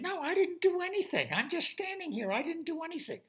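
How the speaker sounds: high, protesting guard voice; guard denying. Acted. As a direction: insist you did nothing, high. Guard denies it.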